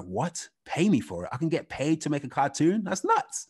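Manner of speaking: rising in stunned disbelief; surprised. Retold or imitated. Retold disbelief at being paid.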